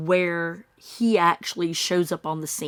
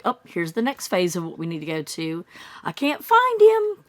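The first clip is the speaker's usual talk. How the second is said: mock casual tone; 4 dB louder than usual. A true-crime vlogger mocking a missing boy's parent, breezy. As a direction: act out a strangely casual parent. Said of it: "would you really say that in that tone".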